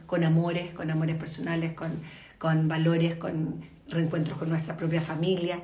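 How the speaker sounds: sincere soft reflection. Real, not acted. Host to viewers about the pandemic, soft and sincere. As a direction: speak gently of loss and finding family again.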